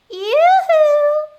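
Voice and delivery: high, loud, drawn-out holler; sing-out witchy yoo-hoo; shouted call. A narrator's reading. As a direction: hail someone across a field. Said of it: "she shouted".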